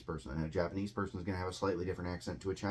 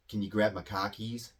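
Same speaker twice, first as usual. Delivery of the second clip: New England Boston accent with dropped r's. Shown as a demonstration. Teacher giving learners an accent example, Boston accent. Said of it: "So you hear that accent".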